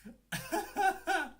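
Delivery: high giggle. Real, not acted. Laughing fit.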